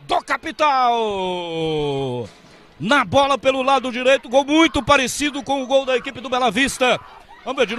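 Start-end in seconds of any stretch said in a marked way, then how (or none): 0.0-2.3 long stretched goal cry; explosive goal commentary. Real, not acted. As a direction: hold the goal call out. Narrador grita gol. (grito de gol prolongado)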